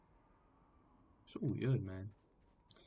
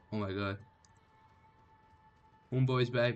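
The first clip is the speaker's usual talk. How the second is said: normal talking voice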